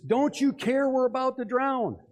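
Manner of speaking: high, panicky acted cry; shouted plea. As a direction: cry out accusingly as a drowning disciple. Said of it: "They started shouting at Jesus".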